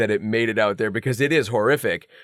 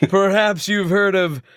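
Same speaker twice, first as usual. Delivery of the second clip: promo announcer; 7 semitones higher, slower than usual. Pretend. Mock promo voice.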